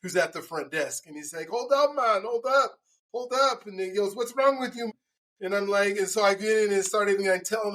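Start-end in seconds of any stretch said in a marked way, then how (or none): 1.5-3.7 raised-pitch, repeated call to stop; retold urgent interjection. Hotel clerk stepping in on a yelling guest, urgent interjection. 4.1-4.9 retold challenge. Hotel clerk confronting the angry guest, mock challenge.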